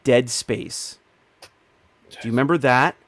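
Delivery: usual speaking voice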